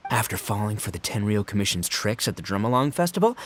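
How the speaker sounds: ordinary talk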